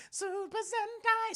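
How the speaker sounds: very high, drawn-out call; high-pitched announcing. Acted. Streamer naming the lookalike design, high drawn-out mock call. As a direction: sing out the name high and slow. Repeats the name in a put-on call.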